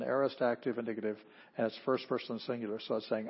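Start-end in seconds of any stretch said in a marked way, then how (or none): none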